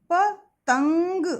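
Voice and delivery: पतंग stretched and loud, the nasal ंग held; teacher drawing out पतंग for class; slow loud demo. Shown as a demonstration. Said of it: "और इसका उच्चारण जो है अंग". (पतंग को ज़ोर से खींचकर बोलना)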